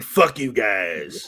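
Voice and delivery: slow, smug brush-off; mock arrogant. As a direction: drawl it out like a snob. Mock diva after making it big.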